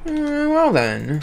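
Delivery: a high, slow teasing imitation of the announcer; mock echo. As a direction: parrot the announcer in a silly high voice. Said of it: "echoes the announcer mockingly".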